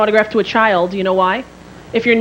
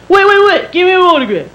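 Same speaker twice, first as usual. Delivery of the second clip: loud, higher mock fan demand; pushy fan; 5 semitones higher, 5 dB louder than usual. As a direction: call the star back urgently. Pushy fan calls her back.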